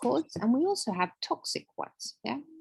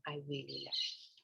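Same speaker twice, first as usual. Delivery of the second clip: much softer, almost breathed; soft near-whisper; 6 semitones lower, 15 dB quieter than usual. Shown as a demonstration. Telling herself in the mirror, very softly. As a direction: hush it to yourself tenderly. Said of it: "You say your name".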